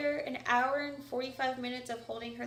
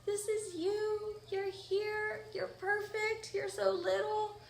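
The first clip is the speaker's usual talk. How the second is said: tearful tender; 8 semitones higher, slower than usual. Retold or imitated. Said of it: "I'm, like, crying".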